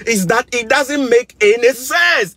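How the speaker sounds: slow, high and loud, fed up; angry. Real, not acted. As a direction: spell out the outrage slowly. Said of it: "'Cause I'm angry".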